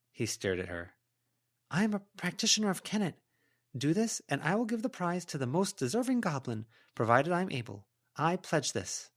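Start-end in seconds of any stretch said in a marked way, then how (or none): 1.7-9.1 higher voice, quick formal vow; heroine's solemn pledge. A narrator's reading. Heroine pledging a prize to the goblin, formal vow. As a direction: swear it like a binding oath.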